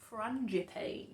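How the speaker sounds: frangipane ending like English "pain"; an English reader's guess at frangipane; unsure pronunciation. Real, not acted. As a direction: say frangipane slowly, last syllable rhyming with rain. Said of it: "I still don't know how you say it".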